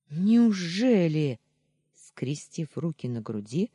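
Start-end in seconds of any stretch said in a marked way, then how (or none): 0.1-1.4 loud, high, drawn-out sarcasm; mocking. A narrator's reading. Hurt wife with crossed arms, mocking. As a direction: stretch it into a jeer. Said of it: "с издёвкой буркнула Таня".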